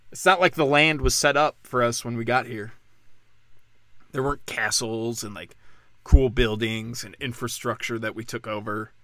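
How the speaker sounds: ordinary talk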